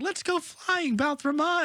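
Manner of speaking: high-pitched, thrilled Morty impression; mock-excited squeal of a boy with a new pet; excited kid character. Boy urging his dragon to fly, excited.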